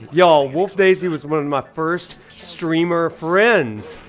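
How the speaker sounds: ordinary talk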